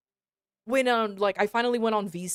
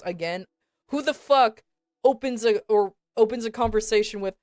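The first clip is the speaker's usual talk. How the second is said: ordinary talk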